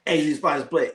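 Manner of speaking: usual speaking voice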